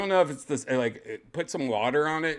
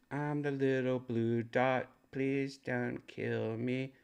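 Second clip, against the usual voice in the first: small, slow, soft voice, slightly raised; pleading little dot; 3 semitones higher, 4 dB quieter, slower than usual. Pretend. A tiny dot begs the storm for mercy, mock pleading. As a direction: plead slowly as a tiny make-believe creature.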